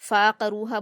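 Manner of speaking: फ़ाक़रूहा with one long फ़ा and no ain; mispronounced long fa. Shown as a demonstration. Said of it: "फ़ा पर अलिफ मद्दा की आवाज़ आ रही है".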